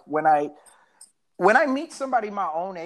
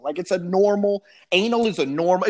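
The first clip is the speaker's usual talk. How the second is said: ordinary talk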